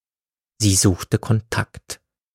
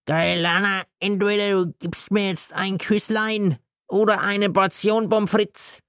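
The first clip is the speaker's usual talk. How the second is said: put-on wheedling drunk; 10 semitones higher than usual. A narrator's reading. God pleads with Gailana for a kiss or fries, drunken.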